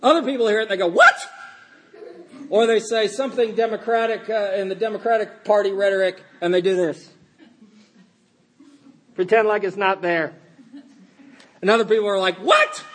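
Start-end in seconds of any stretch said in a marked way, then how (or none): 0.9-1.1 loud, high yelp of disbelief; mock outraged shock. Pastor acts out people hearing political rhetoric, mock shock. 12.4-12.8 very high, loud incredulous yelp; mock outraged shock. Pastor acts out other people's reaction, mock shock.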